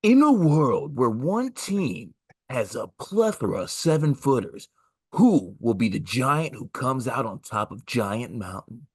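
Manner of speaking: slow, epic trailer voiceover; movie trailer voice. Acted. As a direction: boom it out like a blockbuster trailer. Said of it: "like a movie trailer voiceover guy".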